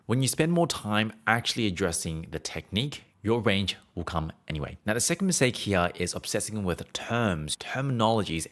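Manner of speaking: ordinary talk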